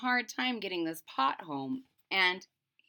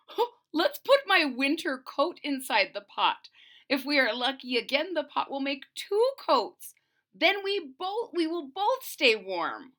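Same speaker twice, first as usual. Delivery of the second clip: excited; 6 semitones higher, 6 dB louder than usual. A narrator's reading. Husband excited about pot.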